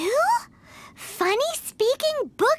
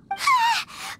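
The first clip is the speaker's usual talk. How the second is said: panicked whimper; 9 semitones higher than usual. Acted. Paimon panics about being punished.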